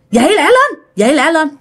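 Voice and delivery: high, pressing call to get up; ghostly wake-up call. Retold or imitated. Dì Bảy urges her sleeping sister to get up, high and pressing. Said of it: "nghe rành rành tiếng của dì Út".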